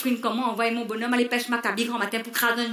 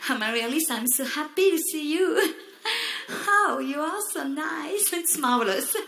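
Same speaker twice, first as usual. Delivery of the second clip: put-on gushing English accent; 5 semitones higher than usual. Fan gushing with fake English.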